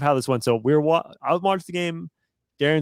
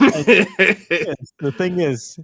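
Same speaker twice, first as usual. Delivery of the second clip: laughing; 4 semitones higher, 5 dB louder, slower than usual. Real, not acted. Laughing while starting the story.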